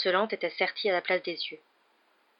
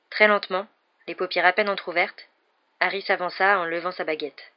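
usual speaking voice

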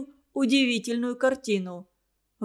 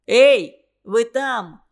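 loud hail through a door; shouting; 8 dB louder, much slower than usual. A narrator's reading. Вася calling to those behind the locked door, shouting. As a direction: punch the first word loud, calling them out. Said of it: "закричал Вася".

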